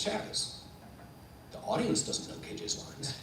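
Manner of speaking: hushed, breath-only delivery; intense whisper. Retold or imitated. Stage manager reassuring panicked actor backstage, whispering.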